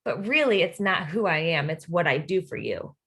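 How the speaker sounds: ordinary delivery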